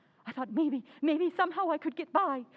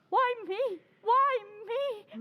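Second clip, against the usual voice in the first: rising panicked cry of why me; terrified cry; 6 semitones higher, much slower than usual. Acted. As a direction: cry out in terror, almost sobbing. Terrified wife cries out.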